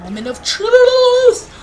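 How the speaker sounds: high, loud, drawn-out cry; suspenseful shout. Real, not acted. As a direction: stretch it out and build to a yell. Tense moment in the game.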